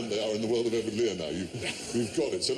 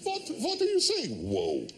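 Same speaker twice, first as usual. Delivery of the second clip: put-on high, squeaky outraged old man; mock-indignant old German grandad; indignant old grandfather; 13 semitones higher than usual. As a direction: play the baffled grandad, pitch way up. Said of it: "He would say".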